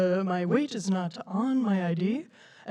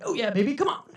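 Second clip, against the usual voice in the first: mock dirty talk to himself, jumping high at the end; playful gym self-talk, higher on the last words; boyfriend's workout pep talk; 4 semitones higher, faster than usual. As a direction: imitate a boyfriend hyping himself mid-workout. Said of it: "it sounds a little like".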